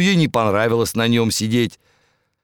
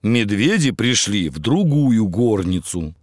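plain reading voice

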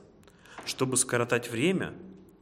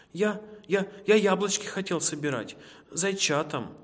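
high, stammering answer; frightened hare; 7 semitones higher than usual. Acted. Hare explaining himself to the wolf, scared stammer.

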